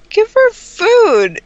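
high, loud and slow; exasperated yell. Real, not acted. Yelling at the game character.

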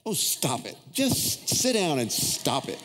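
slow, dismissive scoff; cynical. Retold or imitated. Fed-up viewer talking back to TV preacher, cynical. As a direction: wave it all off, drawing the words out. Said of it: "mad to cynical".

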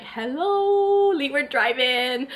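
"Hello" flung up high, a cheeky callout to a rival; playfully calling out the competition; mock-pointed rival shoutout; sassy hello. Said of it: "and I'm like".

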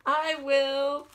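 high, loud eager pig squeal. A narrator's reading. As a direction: squeal it out, keen to eat. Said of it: "squealed the pig".

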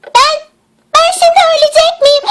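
high, fast, frightened voice; panicked whimper. Acted. Girl fears she will die from the mushroom, panicked. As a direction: squeal as if the end is near.

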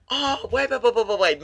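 high, loud urgent interruption; excited realization. Real, not acted. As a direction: stop everything, you've got it. Sudden realization.

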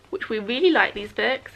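normal talking voice

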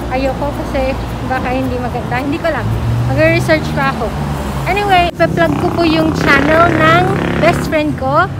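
muffled behind surgical mask; speaking with mouth covered; through a face mask. Real, not acted. Seen 2: Blue surgical mask covers mouth throughout; R frames unmasked.

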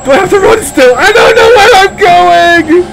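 high sobbing panicked shouts; panicked crying. Real, not acted. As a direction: cry out in terror.

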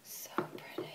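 breathy whisper, no voice; hushed admiring whisper. Real, not acted. Admiring aside.

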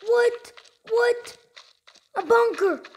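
high, loud delighted yells; excited squeal. Real, not acted. Finally gets the bunker.

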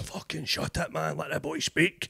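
a soft, slow retold order to pipe down; hushed tough-guy heckler rebuke; venue runner's brother. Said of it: "so he's like".

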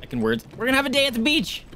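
high, fast, giddy announcement; excited high voice. Real, not acted. Excited sing-song about beach.

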